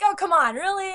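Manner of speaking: exasperated. Acted. Fan objecting to a cop-out in writing, exasperated. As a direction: groan it in disbelief.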